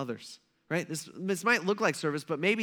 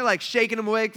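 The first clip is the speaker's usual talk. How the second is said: ordinary delivery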